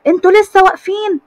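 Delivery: high, loud scolding yell. A narrator's reading. Sister yelling at her brothers still standing around, loud and high. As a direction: pitch it way up and scold. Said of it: "نور قاعدة تصرخ فيهم".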